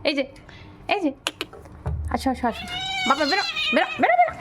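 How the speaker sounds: squeaky falsetto coaxing; high pet call. Real, not acted. Coaxing cat out of box. (খুব চিকন গলায় বিড়ালকে ডাকা)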